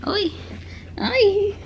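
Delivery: ordinary delivery, nothing marked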